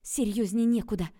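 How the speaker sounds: very loud, emphatic retort; urgent retort. A narrator's reading. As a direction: snap it back with force. Urgent retort while hiding him.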